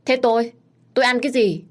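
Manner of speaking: high-pitched peeved question; miffed, pitched well up; indignant complaint. A narrator's reading. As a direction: protest at being left out. Man complains there's no food for him.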